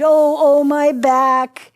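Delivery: very loud, drawn-out groan; storyteller's swelling cry of agony; groaning in pain. A narrator's reading. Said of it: "She groaned".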